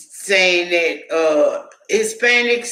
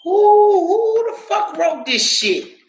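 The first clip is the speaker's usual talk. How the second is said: shrieking disbelief; 12 semitones higher than usual. Retold or imitated. Telling Stephanie about the nursing book, shrieking outrage. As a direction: scream it in outrage. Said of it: "I was like".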